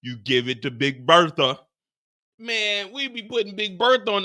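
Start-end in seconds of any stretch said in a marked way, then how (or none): none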